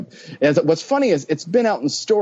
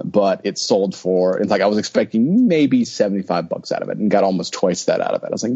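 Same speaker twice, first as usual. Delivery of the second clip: ordinary talk, nothing marked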